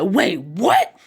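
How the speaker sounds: loud, rising double take; stunned and loud; startled disbelief. A narrator's reading. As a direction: blurt it out, voice shooting up at the end. All react together in shock.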